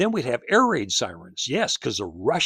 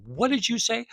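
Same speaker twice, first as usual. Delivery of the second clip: teacher's surprise; 11 semitones higher than usual. Retold or imitated. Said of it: "she goes".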